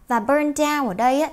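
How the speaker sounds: usual speaking voice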